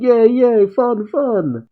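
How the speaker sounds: put-on high, playful little-kid cheering; gleeful kid. Pan rides on Piccolo's back, gleeful. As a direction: squeal with childish fun.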